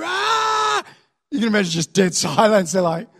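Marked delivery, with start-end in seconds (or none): 0.0-0.8 long high-pitched triumphant yell; excited yell. Acted. Israelites reaching the top of the hill, excited yell. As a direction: draw out one big mock cheer.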